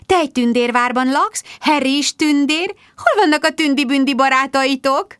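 put-on high, gushing voice for a small child; trilled, high-pitched coaxing; trilling baby talk. A narrator's reading. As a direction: coo it up high and sugary. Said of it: "trillázta a taktikát változtatva Rebecca".

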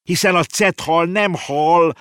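high, loud, drawn-out objection; shrieked interjection. Acted. Boy butting in to correct the horse, yelling. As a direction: cry out the correction slowly. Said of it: "rikkantott közbe Konrád".

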